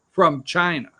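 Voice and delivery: ordinary delivery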